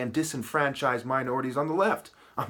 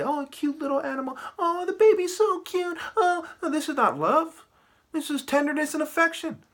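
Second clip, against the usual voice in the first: high, sing-song mock cooing over a pet; mocking gush in a much higher voice; cooing baby talk; 13 semitones higher than usual. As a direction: coo like someone gushing at a cute animal. Mocking people cooing at animals.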